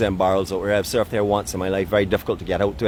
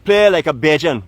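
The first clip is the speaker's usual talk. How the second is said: louder, higher Barbadian accent; 6 semitones higher, 7 dB louder than usual. Shown as a demonstration. Man coaching the sulking dictator, emphatic Barbadian accent. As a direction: call it out in a Bajan accent. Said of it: "say it with a Barbadian accent".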